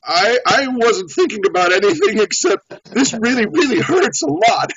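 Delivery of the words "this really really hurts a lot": high-pitched complaint of pain; acted pain. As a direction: act out hurting, pitch climbing. Acts out his thought when stung.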